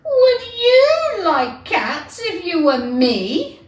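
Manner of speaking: high, loud, slow crying squeak; tearful outcry. Acted. The mouse wails at Alice, high and tearful. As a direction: wail it, near tears, drawn out. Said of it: "cried the mouse".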